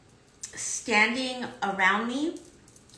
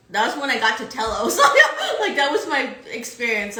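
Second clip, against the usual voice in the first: louder, laughing through the words; joyful laugh remembering the moment; 2 semitones higher, 9 dB louder, faster than usual. Real, not acted. Laughing recalling reaching Telos.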